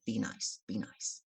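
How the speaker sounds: soft, low aside, fading toward a whisper; acted under-the-breath caution; hushed reminder. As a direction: quietly shush the people at your table. Hushing grumbling tablemates.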